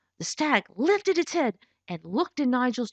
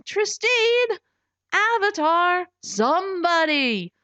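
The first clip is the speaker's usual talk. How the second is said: higher, louder calls; desperate calling; 8 semitones higher, 3 dB louder, slower than usual. Acted. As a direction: call out to anyone at all. Trapped, calling anyone.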